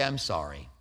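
very soft, low apology; just own it, gently; simple sincere sorry. Shown as a demonstration. Preacher modelling a humble apology, soft and low. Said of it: "But to simply say".

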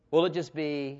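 normal talking voice

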